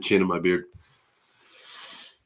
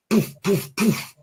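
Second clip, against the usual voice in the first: high soft poof sounds; 9 semitones higher, 5 dB quieter, slower than usual. Pretend. Greeting a chatter playfully.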